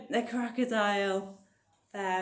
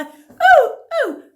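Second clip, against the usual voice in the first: very high, loud monkey hoots; monkey whoops; 19 dB louder than usual. Pretend. Monkey sounds in action song.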